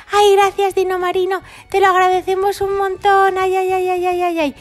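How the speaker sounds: high, sighing mock relief; hammed-up relieved little dinos; relieved high dinosaurs. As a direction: thank him, still shaky and high.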